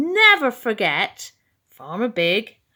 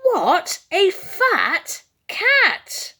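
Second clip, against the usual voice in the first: high, loud, slow horrified cry; alarmed mouse; 10 semitones higher, 6 dB louder, much slower than usual. A narrator's reading. Mouse spots the huge cat, slow high horrified cry. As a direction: drag out the shock at the sight of the cat.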